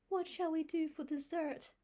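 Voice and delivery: put-on high, loud young girl asking. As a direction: ask like a dismayed young girl. Anne's voice.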